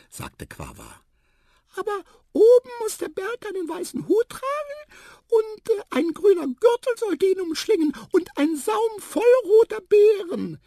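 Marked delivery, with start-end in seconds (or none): 1.8-10.6 very high, loud, excited squeak; squeaky mouse. A narrator's reading. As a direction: pipe up loudly with more details. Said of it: "piepste Tiputip". (laut und eifrig piepsende Maus)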